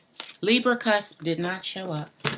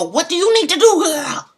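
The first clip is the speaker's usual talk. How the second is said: put-on a much higher, louder, fast sassy girlfriend voice; sassy advice voice; 9 semitones higher, 8 dB louder than usual. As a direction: put on a high, loud sassy voice and hand out advice. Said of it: "I go".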